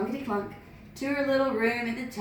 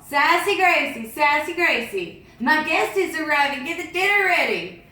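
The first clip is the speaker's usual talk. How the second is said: higher, louder calling out across the house; master calling; 5 semitones higher, 5 dB louder than usual. Acted. Master calling Gracie to get dinner, loud. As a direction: call her from another room. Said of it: "she heard the master call".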